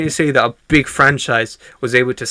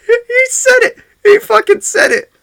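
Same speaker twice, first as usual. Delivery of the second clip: high, loud, near-crying cheers; ecstatic screaming; 6 dB louder than usual. Retold or imitated. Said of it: "They're like".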